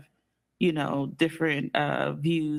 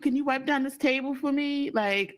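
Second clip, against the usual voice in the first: mock fool; 8 semitones higher than usual.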